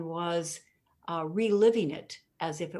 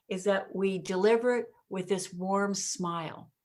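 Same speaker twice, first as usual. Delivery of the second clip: normal talking voice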